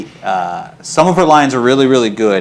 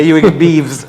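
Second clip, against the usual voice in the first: laughing; 2 semitones higher than usual. Retold or imitated. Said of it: "instead of beef".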